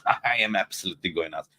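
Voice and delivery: ordinary talk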